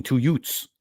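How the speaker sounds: "Utes" with no th, ending in a hard t; Vinny's "Utes". Retold or imitated. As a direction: say "Utes" like youths in heavy New York speech. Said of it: "I sound like fucking my cousin Vinny".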